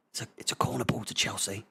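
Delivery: fast hushed whisper; quick unvoiced whisper; whispered commentary. Real, not acted.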